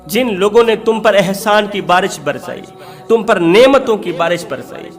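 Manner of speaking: ordinary talk, nothing marked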